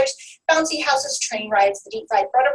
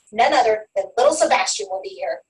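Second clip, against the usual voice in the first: normal talking voice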